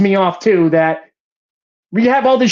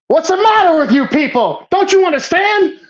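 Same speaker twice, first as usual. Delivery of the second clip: higher, mock-exasperated juror; imitating a ranting juror; 8 semitones higher than usual. Reviewer quoting a juror berating the others, mock exasperation. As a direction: play the bigot shouting at the room.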